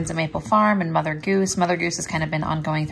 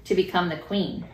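put-on reading to children; 4 dB quieter than usual. Reads Bible story, prays with child.

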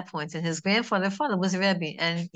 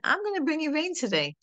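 voicing Hashem; 9 semitones higher than usual. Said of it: "No, Hashem said".

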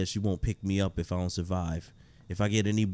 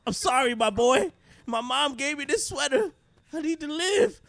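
much higher desperate pleading; 14 semitones higher than usual. Acted. Camper begging Jason to spare him, high and desperate. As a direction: beg for your life.

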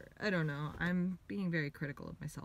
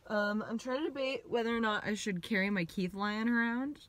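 normal talking voice

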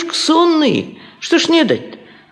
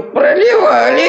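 laughing; 4 semitones higher, 3 dB louder than usual. Acted. Gossiping about the wedding.

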